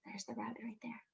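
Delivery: soft secret whisper. Acted. Showing children the rabbit, whispered. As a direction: whisper it so the rabbit won't run.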